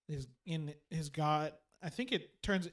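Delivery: ordinary talk, nothing marked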